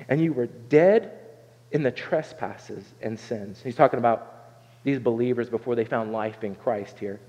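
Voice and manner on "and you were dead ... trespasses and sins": slow, grave reading, each word weighed; solemn scripture reading. Acted. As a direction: read it slowly like a verdict. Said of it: "He says".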